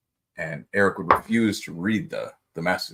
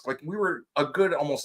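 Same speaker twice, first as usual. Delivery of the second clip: usual speaking voice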